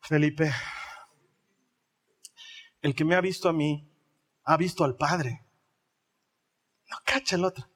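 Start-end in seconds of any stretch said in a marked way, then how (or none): 0.0-5.5 lower, calm and patient; patient Jesus. Retold or imitated. Jesus patiently answering Philip, lower and calm. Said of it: "Jesús lo mira".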